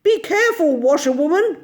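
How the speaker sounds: alarmed gentleman calling out. A narrator's reading. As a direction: shout a warning to the driver. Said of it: "call out".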